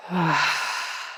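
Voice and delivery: long breathy exhale through the mouth; big open-mouthed letting-go sigh; audible sigh out. Shown as a demonstration. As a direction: sigh everything out in one long releasing breath. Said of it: "sighing everything out the mouth".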